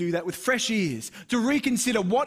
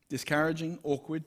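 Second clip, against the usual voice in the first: ordinary talk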